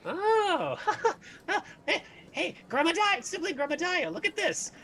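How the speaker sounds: very high, giggly gnome calling his friend; delighted gnome. Acted. Gnome calls the orc over in very high giggly voice. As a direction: squeal with glee at the find. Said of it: "he picks it up and he goes".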